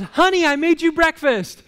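wife's chirpy wake-up call; sweet wife. Retold or imitated. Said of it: "and she says".

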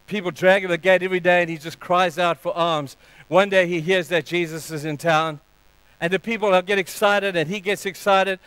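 ordinary talk, nothing marked

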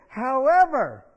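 reading the letter's turn; emphatic however. Real, not acted. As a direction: land the word however hard. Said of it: "Say it like you mean it".

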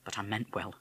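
soft, private musing; quiet regretful thought; inner thought. A narrator's reading. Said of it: "Mister Holsworth thought".

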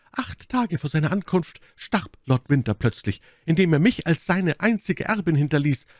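high, urgent lady's voice; Milady, eindringlich klagend. Acted. Milady tells her story. (Mylady, hohe klagende Frauenstimme)

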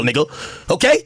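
ordinary talk, nothing marked

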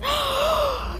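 sharp delighted gasp at a find; high, thrilled intake of breath; surprised gasp. Real, not acted. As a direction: catch your breath in surprise. Sees new merchandise.

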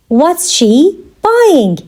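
very slow, pause before "buying"; measured, separated words; slow teaching repeat. Shown as a demonstration. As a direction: draw the question out for learners. Teacher repeats question slowly for learners.

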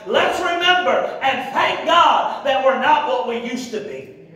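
high, emphatic pulpit exhortation; fiery preaching. Real, not acted. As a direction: drive each phrase home to the congregation. Preaching at full force.

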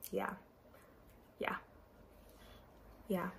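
ordinary talk

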